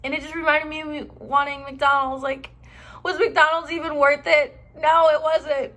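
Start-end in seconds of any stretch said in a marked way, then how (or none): 3.0-5.7 loud, higher-pitched indignation; raised, emphatic regret; exasperated self-reproach. Real, not acted. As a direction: ask it and answer it, exasperated at yourself. Scolding herself for the trip.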